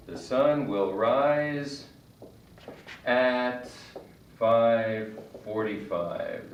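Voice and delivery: very slow, careful The sun will rise; slow example. Shown as a demonstration. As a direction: stretch out every word of The sun will rise. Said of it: "For example".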